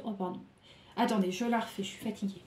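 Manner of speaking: ordinary talk, nothing marked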